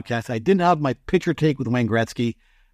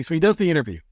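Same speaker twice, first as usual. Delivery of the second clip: normal talking voice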